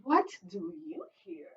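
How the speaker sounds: whisper. Acted. Whispered line in story.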